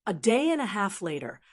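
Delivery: usual speaking voice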